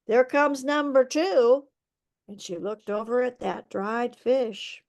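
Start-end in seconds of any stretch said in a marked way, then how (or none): 0.1-1.7 put-on yawning old woman calls out, higher; old woman calling out. A narrator's reading. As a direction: stretch out the Ah like a yawn. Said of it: "she called out".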